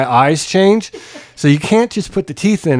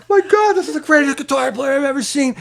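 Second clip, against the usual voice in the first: awed fan; 12 semitones higher than usual. Retold or imitated. Imitating awed crowd.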